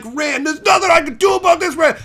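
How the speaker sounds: high, loud mock-whining yell; whiny angry shout. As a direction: plead in frustration at full volume. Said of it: "And he's like".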